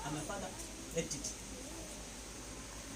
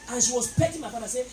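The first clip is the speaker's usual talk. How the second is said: ordinary talk, nothing marked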